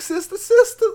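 mock fanfare. Host jokingly welcoming the show back, sing-song. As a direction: hail the show's return. Hosts joke about reruns.

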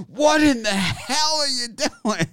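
high, slow mock yell at the TV. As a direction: holler at the screen in disbelief. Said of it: "I'm like".

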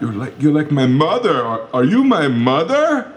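high, whiny exasperated voice; whiny complaining. Acted. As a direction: whine it like a fussy man. Detective whining, voice rising.

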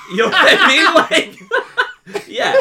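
high, loud, talking through laughter; helpless real laughter on every phrase; cracking up. Real, not acted. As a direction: laugh so hard the words barely get out. Cracking up at the room joke.

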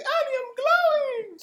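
high loud wailing Annie; Jar Jar impression. Retelling Robot Chicken Jar Jar, high loud wail. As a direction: wail it like a cartoon alien. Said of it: "And he's like".

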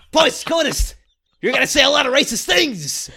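loud, high, frantic mad scientist; Doc Brown impression. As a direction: warn him like a panicked Doc Brown. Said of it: "another Christopher Lloyd".

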